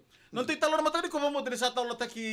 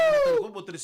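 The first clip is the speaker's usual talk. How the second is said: shouting; 8 dB louder than usual. Real, not acted. Sudden loud outburst.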